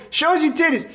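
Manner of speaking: high-pitched emcee call; hype man. Retold or imitated. Emcee to number four, hyped. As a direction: call it out like a rowdy host. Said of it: "pumping up the crowd".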